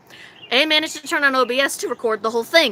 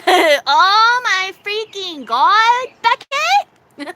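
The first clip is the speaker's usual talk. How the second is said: high, loud, very drawn-out gush; hammed-up exclamation; 8 semitones higher, 5 dB louder, much slower than usual. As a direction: stretch it out in mock amazement. Exaggerated mock gush to viewer.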